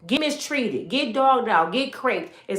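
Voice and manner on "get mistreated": ordinary delivery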